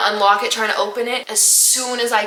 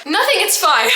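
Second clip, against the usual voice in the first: high voice breaking into laughter; mock reassurance; 10 semitones higher, 4 dB louder than usual. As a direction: insist all is well, pitched high and giggly. Tearful denial, laughing retelling.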